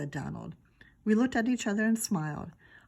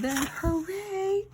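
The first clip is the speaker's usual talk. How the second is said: higher, slower drawn-out cheer; hooray; 13 semitones higher, slower than usual. Shown as a demonstration. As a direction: cheer it with full joy. Re-read after apology.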